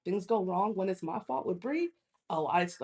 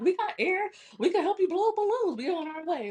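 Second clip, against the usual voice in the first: silly friends; 12 semitones higher than usual. Retold or imitated. Friends offering help by phone, imitated in silly high voices. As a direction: imitate goofy friends calling in. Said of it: "You guys are so silly".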